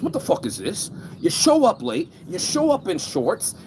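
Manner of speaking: mock a furious Hoffa scolding a late guest; angry mobster. Hoffa scolding the man who showed up late, hammed-up outraged mobster. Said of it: "And he goes".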